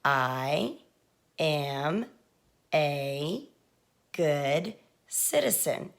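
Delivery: one word at a time, long pauses between; word-by-word dictation. Shown as a demonstration. Teacher modelling a first sentence for young writers, slow word-by-word dictation. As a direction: draw out each word separately, slowly.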